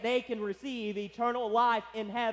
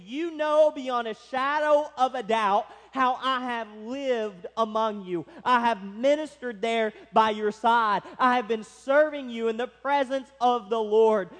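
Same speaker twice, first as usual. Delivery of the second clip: louder, insistent testimony, leaning on "You know"; forceful emphasis; 5 dB louder than usual. Retold or imitated. Preacher speaking as Paul to the elders, forceful and emphatic. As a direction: drive home "beyond a shadow of a doubt" with force. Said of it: "trying to communicate the force here".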